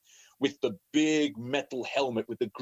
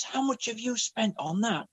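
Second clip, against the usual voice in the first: a higher, mock-scolding wife voice; aunt's shocked question; 9 semitones higher than usual. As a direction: voice the wife's alarm at the cost. Wife reacts to his spending.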